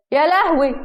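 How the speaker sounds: loud, high-pitched exclamation; shocked. A narrator's reading. Husband reacts to wife's cheeky remark, shocked. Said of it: "قالها بصدمة تانية".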